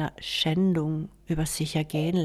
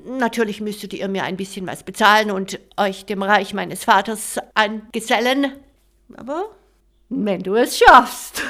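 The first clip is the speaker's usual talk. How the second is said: gloating warlord; 3 semitones higher, 7 dB louder than usual. Acted. Voices the warlord.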